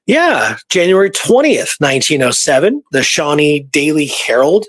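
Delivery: ordinary talk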